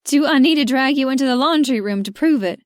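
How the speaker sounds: teasing challenge. A narrator's reading. Georgia teasing Mac after he asks if she loves him, playful. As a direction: tease him, half laughing through tears.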